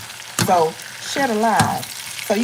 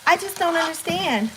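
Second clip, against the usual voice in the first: higher-pitched mock complainer; put-on baffled voice; 7 semitones higher, 4 dB quieter than usual. Imitating people who don't understand loan non-payers, put-on baffled voice. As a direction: pitch it up as a puzzled critic. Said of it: "I'm just picking up a voice".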